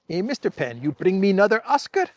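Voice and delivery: playful Zelensky impression, teasing. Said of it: "my Zelensky voice".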